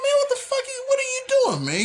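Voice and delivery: very high, disbelieving cry; incredulous. Retold or imitated. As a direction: voice total disbelief at him.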